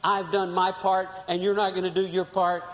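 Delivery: rehearsed angry speech; aggrieved complaint. Retold or imitated. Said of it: "And I was saying".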